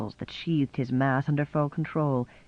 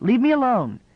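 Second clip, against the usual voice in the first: much higher, louder outburst; angry snap; 9 semitones higher, 5 dB louder than usual. A narrator's reading. Nichol lashing out at Jessie, angry and loud. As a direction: push her away with the voice. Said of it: "he snapped".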